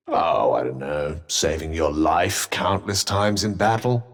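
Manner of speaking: low, mock-casual sarcasm; sarcastic musing. Still waiting for thanks after saving a life, sarcastic. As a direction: feign having to think, pitched low.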